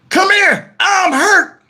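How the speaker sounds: high, pained holler; acted plea for help. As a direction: wail upstairs for help, hammed-up. Calls partner upstairs for help.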